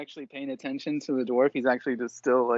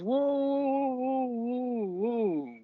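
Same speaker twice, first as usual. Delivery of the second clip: dwarf calming; 9 semitones higher, much slower than usual. Acted.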